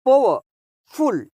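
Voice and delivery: Powerful with a clear pause before फुल; two separate beats in powerful; split into parts. Shown as a demonstration. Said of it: "ये टू syllable adjectives कहलाते हैं".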